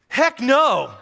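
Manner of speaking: angry outburst. Retold or imitated. Pastor in his truck, angrily refusing to fail. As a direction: snap it out like you're fed up. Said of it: "I got mad".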